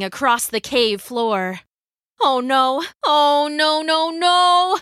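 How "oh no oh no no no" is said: higher and louder with each no; rising panic. A narrator's reading. Lydia disarmed and falling before lions, panicking. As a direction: build alarm into a long last no. Fallen fighter cornered by lions.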